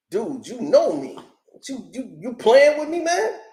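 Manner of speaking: hammed-up offended street voice. [name] grabs the phone from [name], mock outraged. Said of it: "took the phone out of his hand like".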